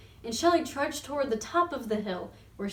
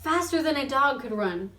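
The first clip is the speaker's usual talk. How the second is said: plain reading voice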